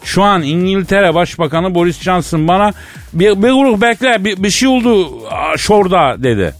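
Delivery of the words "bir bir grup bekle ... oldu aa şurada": higher mock Çankırı dialect quote; passing on the PM's words in Çankırı accent; retelling the PM's reply in a light Çankırı accent; quoting in Çankırı accent. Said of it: "Çankırı şivesi çok dominant".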